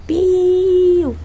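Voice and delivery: one long high piu; prolonged chick peep. Shown as a demonstration. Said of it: "um piu prolongado".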